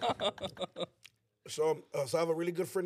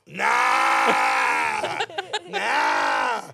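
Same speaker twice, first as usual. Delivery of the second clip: mock shouted refusal; 7 dB louder than usual. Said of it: "He's like".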